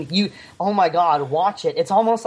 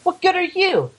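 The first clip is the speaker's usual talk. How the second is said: much higher, mock-outraged disbelief at the screen; incredulous outburst; 12 semitones higher than usual. Podcaster reliving his reaction to the film, high mock outrage. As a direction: throw it at the movie like a betrayed fan. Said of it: "I'm like".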